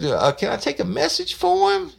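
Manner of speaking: high, put-on voice of the woman on the phone; old woman's voice. Woman answering a prank phone call, high put-on voice.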